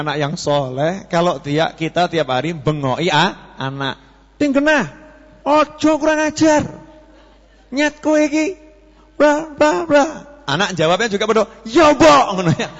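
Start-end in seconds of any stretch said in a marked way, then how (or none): 4.4-10.0 scolding mother. Retold or imitated. Mother yelling at her rude child, harsh scolding. 11.7-12.3 put-on rude child's retort. Child answers back rudely.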